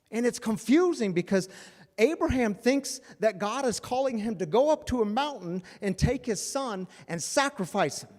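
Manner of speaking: loud, high, driving sermon voice; preaching at full force. Acted. Pastor preaching to his congregation, full force. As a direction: preach it out to the whole room.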